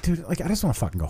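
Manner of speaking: just wants to go home; worn-out mock plea to the friend; fast, soft, tired plea. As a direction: rattle it off under the breath. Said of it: "I'm just like".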